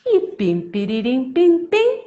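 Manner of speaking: playful chanted sign-off with a drawn-out last word; sing-song story ending; storyteller's closing rhyme. Acted. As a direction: ring out the ending for children.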